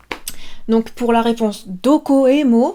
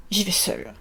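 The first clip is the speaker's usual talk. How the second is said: dramatic hush; 4 semitones lower, 8 dB quieter than usual. Acted. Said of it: "toujours dramatique".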